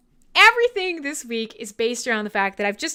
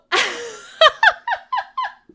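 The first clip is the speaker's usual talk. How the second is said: high giggle; 13 semitones higher, 6 dB louder than usual. Real, not acted. Laughs at chat's joke.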